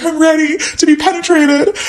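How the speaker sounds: hammed-up, high-pitched movie heroine; movie lover. Narrator mocking how movies show sex, theatrical eagerness. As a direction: play it big and high like a film love scene. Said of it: "It's like in the movies".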